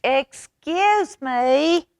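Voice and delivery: high and slow, pressing excuse me. Acted. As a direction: stretch it out to be heard over the noise. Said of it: "even louder this time".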